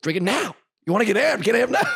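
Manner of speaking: high, loud pump-up yell; hype shout. Retold or imitated. Dalton hyping him to drink, high pump-up shout. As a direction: fire him up like a coach. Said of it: "He's like".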